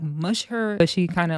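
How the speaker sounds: usual speaking voice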